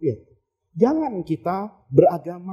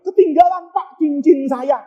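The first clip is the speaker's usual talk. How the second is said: child's mock complaint; 5 semitones higher, 5 dB louder than usual. Abu Bakar's child, mock protest.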